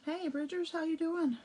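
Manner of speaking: bright, high hello to viewers; cheerful greeting. Real, not acted. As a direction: greet them warmly and high.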